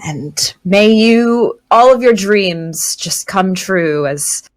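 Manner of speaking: slow, drawn-out sarcastic well-wishing; syrupy, drawn-out fake blessing. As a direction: stretch each word with mock sweetness. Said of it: "drawn out".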